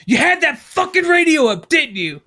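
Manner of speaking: high-pitched mock scolding, dropping on the tag question; hammed-up accusation; accusing dad. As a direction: imitate an angry father laying blame. Acted accusation from angry dad.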